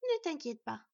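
plain reading voice

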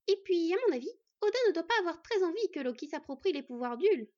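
brisk, knowing housewife; Beyla's high voice. A narrator's reading. Beyla guessing at Odin's motives, high and lively.

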